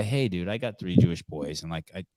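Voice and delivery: quiet, even, no heat; a calm word to the rude buyer. Shown as a demonstration. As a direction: calmly set him straight. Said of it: "in a really calm way".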